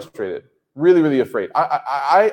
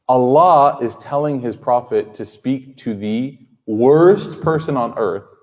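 normal talking voice

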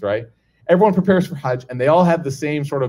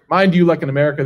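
ordinary talk

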